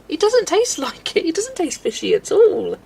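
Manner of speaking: high, loud retold objection; indignant protest. Daughter answering mum's jibe about her crisps, indignant protest.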